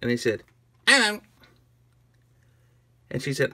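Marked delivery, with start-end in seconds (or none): 0.9-1.2 high, loud little one-syllable yelp; minion voice. Acted. Minion answering Agnes at her toy sale, high yelp. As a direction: squeak it like a tiny minion. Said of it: "up came a minion".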